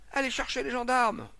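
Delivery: loud, high-pitched command; urgent order. Acted. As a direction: send for help in alarm. Crowd calls for police.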